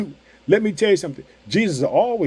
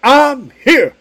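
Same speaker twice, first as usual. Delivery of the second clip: higher, louder proclamation; declaring presence; 7 semitones higher, 8 dB louder than usual. Retold or imitated. Preacher voicing Jesus showing up in a storm, proclaiming it loud. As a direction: announce it with triumph. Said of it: "and saying".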